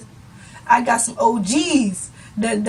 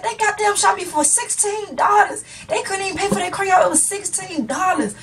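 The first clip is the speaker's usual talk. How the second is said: indignant driver; 8 semitones higher than usual. Retold or imitated. Driver retells outrage, high voice.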